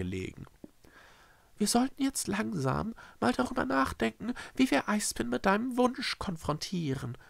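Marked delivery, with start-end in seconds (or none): 1.6-7.2 high, careful suggestion; cautious. A narrator's reading. Echo broaches the wish with Schreckse, cautiously. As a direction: tread carefully in a high character voice. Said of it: "sagte er vorsichtig".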